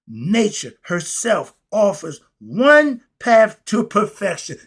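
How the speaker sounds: building steam as he preaches; excited. Real, not acted. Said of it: "I'm getting excited".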